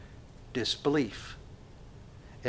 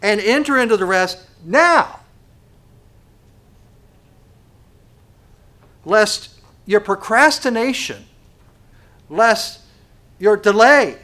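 fervent preaching; 7 semitones higher, 10 dB louder than usual. Acted. Preaching urgent paraphrase.

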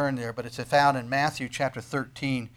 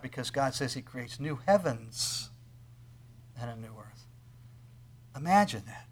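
ordinary talk

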